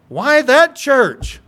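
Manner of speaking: loud, high, slow disbelief; acted exasperated questioning; incredulous protest. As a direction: punch out each word, baffled and judgy. Acts out judgy disbelief at choice.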